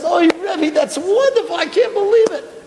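high, delighted disbelief; thrilled young man who can't believe it; rising joyful exclamation; overjoyed student. Retold or imitated. Student hears the Rebbe is coming.

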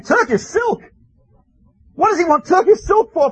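high, disbelieving cry of the townspeople; a startled villager, pitched high and baffled; incredulous townsfolk. Retold or imitated. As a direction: ask it as if the request makes no sense. Townsfolk baffled by Rebbe's demand.

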